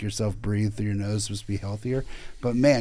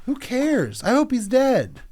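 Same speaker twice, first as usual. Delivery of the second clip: mock callous outburst; 11 semitones higher, slower than usual. Provocative mock rant.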